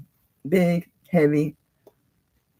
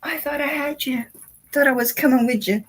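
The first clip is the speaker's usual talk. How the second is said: higher whiny pet voice; voicing the dog; 9 semitones higher than usual. Owner speaks for her dog who thought he was coming along, higher whiny voice. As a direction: play the dog's pleading thoughts. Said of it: "He's like".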